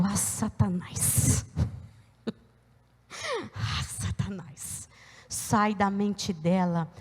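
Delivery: low, slow, drawn-out command under the breath; hushed rebuke. Retold or imitated. Mother ordering Satan out of daughter's mind, low and hushed. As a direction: rebuke him softly and deliberately.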